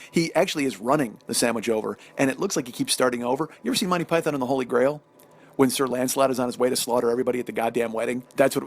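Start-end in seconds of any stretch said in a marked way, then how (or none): none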